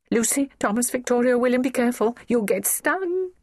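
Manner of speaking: high, urgent mother's call; high-pitched panicky mother; alarmed warning. A narrator's reading. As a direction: reel off names in rising alarm. Mother warns her children.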